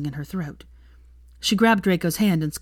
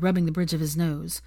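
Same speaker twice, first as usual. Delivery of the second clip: usual speaking voice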